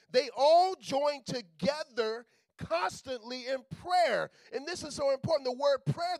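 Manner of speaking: loud, driving sermon delivery; a pastor building to loud, fervent preaching; preaching at full force. Acted. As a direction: preach it hard to the congregation.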